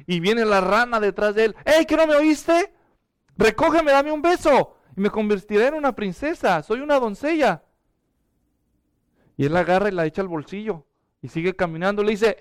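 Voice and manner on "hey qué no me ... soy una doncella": a talking frog chasing him and demanding to be heard; insistent frog voice. Acted. Frog insists, old man ignores.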